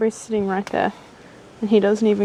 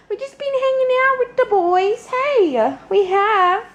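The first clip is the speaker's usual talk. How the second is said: high sing-song voice for the dogs; pet talk voice; 12 semitones higher, 4 dB louder than usual. Real, not acted. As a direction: coo at the dogs. Talking to the dogs.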